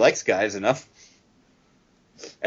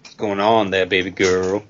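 low Roman Reigns impression; wrestling promo; 6 semitones lower than usual. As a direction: drop low for Baby Girl. Mimicking Roman Reigns promo line.

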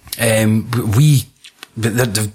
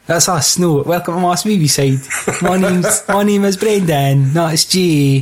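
higher-pitched fake American introduction; mock American accent; 5 semitones higher than usual. Host impersonating the American compadres introducing themselves, mock American accent. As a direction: play the American host, badly. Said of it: "our American compadres that sound like".

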